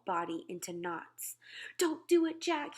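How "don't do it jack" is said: high, pleading screech; terrified screech. A narrator's reading. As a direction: screech it, begging to be spared. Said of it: "he screeched".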